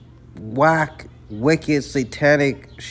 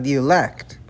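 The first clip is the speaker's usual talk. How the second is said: ordinary talk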